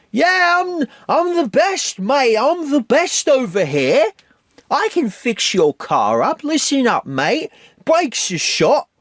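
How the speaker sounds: mock British accent, high and drawn out, fading to a whisper at the end; terrible British accent. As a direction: play a cocky Brit with a deliberately bad British accent. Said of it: "my terrible British accent".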